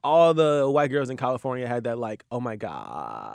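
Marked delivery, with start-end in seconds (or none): 2.3-3.3 low, slow, creaky oh my God; vocal fry. Retold or imitated. Host imitating California girls of the Kardashian era, slow low fry. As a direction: drag it down into a lazy creak. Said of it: "like, vocal fry".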